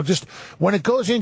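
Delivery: a high, lightly voiced mock of the kicker; kicker's advice. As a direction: play the meddling kicker a bit high. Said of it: "He's like, you know".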